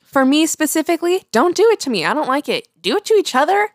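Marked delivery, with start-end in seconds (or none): none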